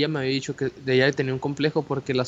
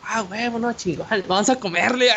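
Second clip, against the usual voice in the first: higher, pumped-up cheer; thrilled; 8 semitones higher than usual. Retold or imitated. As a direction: celebrate like you just won. Thrilled after she says yes.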